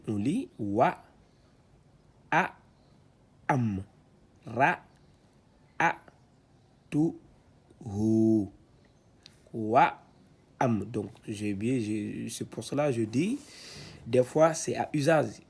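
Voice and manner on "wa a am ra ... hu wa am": syllabes détachées, plus fortes; lecture syllabée. Shown as a demonstration. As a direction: scande chaque syllabe. (« Wa, ma, ka, sa, ba » récité syllabe par syllabe)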